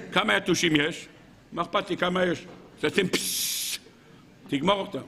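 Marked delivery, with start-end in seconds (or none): 3.1-3.8 long unvoiced "psss"; imitated spray hiss. Rabbi miming spraying mosquitoes away, hissing.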